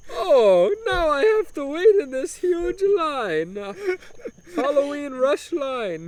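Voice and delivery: put-on high, slow, complaining broken Italian accent; whiny Italian accent. Humdinger stuck in a drive-thru line, whining in a mock Italian accent. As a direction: moan about the queue in a hammed-up Italian accent. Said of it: "putting on an obnoxious broken Italian accent".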